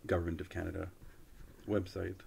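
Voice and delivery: usual speaking voice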